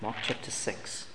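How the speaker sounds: quiet aside while looking up the verse; muttering to himself. Real, not acted. Preacher searching his Bible, mutters the reference quietly. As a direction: mumble it under your breath, half to yourself.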